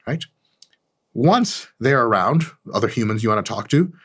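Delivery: ordinary talk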